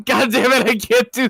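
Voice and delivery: cracking up mid-sentence; breaking into real laughter; laughing. Real, not acted. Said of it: "while laughing".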